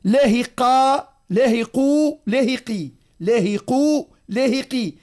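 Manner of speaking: playful demo of the wrong ishmam vowels; mock wrong vowels. Shown as a demonstration. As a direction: exaggerate each vowel to show the mistake. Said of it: "الفم يتحرك حركة واحدة فقط".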